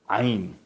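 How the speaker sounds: عین with a throaty Arabic ain; عین said with Arabic throat sound. Shown as a demonstration.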